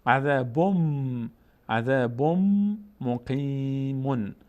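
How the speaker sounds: very slow عذابم with long hummed m; tanwin merged into mim. Shown as a demonstration. Teacher models the merger, عذابم drawn out on m. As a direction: hold the m of عذابم into مقیمون.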